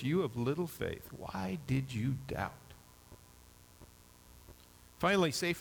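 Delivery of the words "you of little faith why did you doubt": a loving teacher's sigh of disappointment; gentle rebuke from Jesus. Retold or imitated. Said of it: "and says".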